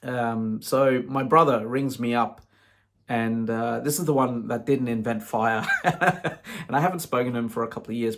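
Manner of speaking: ordinary talk, nothing marked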